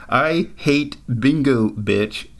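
slow imitation of Tenzin's accent. Narrator relives coworker's classic line, slow Tenzin accent imitation. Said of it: "in his crazy accent".

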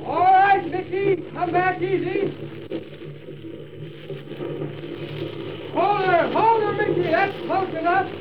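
high, urgent calls over the blaze; calling out. Acted. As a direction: call out to the engine driver. Shouting directions over the fire.

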